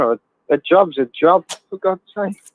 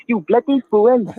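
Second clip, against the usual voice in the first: high, drawn-out Welsh mock insult; Welsh accent; 9 semitones higher, slower than usual. Caller carries on the sketch impression, slow high Welsh scolding. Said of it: "the best Welsh accent I can do".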